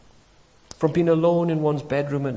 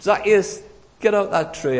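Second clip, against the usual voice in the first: loud, raised call up to someone; 7 semitones higher, 6 dB louder than usual. Acted. Preacher as Jesus calling Zacchaeus down from the tree, calling out. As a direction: call out higher and louder, like a summons.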